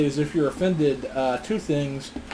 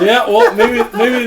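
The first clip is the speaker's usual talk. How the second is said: laughing; 7 semitones higher, 6 dB louder, slower than usual. Real, not acted. Laughing after the joke.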